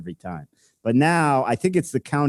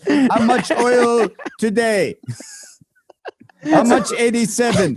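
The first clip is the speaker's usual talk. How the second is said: imitated Middle Eastern accent; 10 semitones higher, 4 dB louder than usual. Podcast host mocks oil seller calling, hammed-up Middle Eastern accent. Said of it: "That's a good accent".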